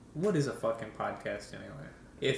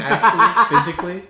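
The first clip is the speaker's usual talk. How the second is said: words bursting out through laughter; laughing; 7 semitones higher, 14 dB louder than usual. Real, not acted. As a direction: laugh while you insist. Laughing mid-explanation.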